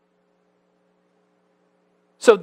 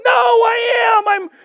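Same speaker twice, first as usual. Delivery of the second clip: insistent excitement; 10 semitones higher, 4 dB louder than usual. Retold or imitated. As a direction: insist loudly that you really are thrilled. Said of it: "I think I'm, like, being really excited".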